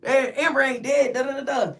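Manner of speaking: mocking jeers. Everybody on the pod laughs at her, imitated high mocking jeers. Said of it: "Everybody laughed at me".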